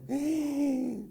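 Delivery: big shocked intake of breath; sharp high gasp. Retold or imitated. Husband hears his wife's cold goodbye, sharp high gasp. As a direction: gasp as if scandalized.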